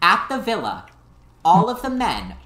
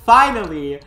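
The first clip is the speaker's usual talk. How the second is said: normal talking voice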